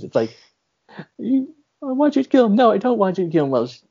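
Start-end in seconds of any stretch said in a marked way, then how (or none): 1.2-2.5 high-pitched mock woman's voice; imitating the wife. Wife asking him to kill her husband, imitated high voice. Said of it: "she talk about her husband". 2.6-3.5 higher mock woman's voice; lightly put-on high wife's voice, taking it back; imitating the wife. Wife retracting her request about her husband, imitated high voice. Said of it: "she talk about her husband".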